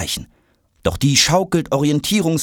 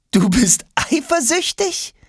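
high, drawn-out, teasing; amused question; slower than usual. A narrator's reading. Boy to the retainer box, teasing. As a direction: ask slowly with amused surprise. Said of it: "Jonas lacht".

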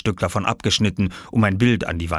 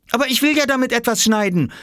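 put-on fast, higher, out of breath child; panting boy; 4 dB louder, faster than usual. Oskar out of breath after running to the farmer. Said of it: "keuchte Oskar".

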